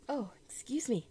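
breathy whispered apology after sneezing; hushed excuse me, no voice; whispering. Real, not acted.

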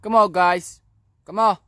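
loud, high-pitched call to the birds. Real, not acted. Pigeon keeper calls his flock down to land, calling out loudly. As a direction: holler up to birds flying overhead.

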